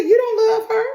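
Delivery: very high, loud incredulous question; shocked disbelief. Retold or imitated. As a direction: imitate a stunned friend, voice shooting up. She is shocked by his answer.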